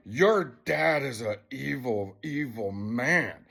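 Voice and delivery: slow, weighted retold verdict; grave condemnation. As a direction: draw it out to make the child hear it. Retells telling child about dad.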